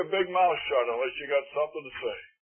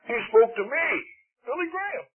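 swooning up high on Billy Graham; mock starstruck; 8 semitones higher than usual. Preacher mocking a fan who met Billy Graham, swooning high. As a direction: gush like a dazzled fan. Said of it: "never could get it out".

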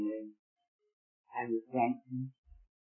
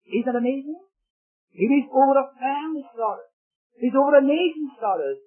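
high, raised pulpit voice; impassioned preaching; 8 semitones higher, 5 dB louder than usual. Real, not acted. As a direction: preach it with urgent feeling.

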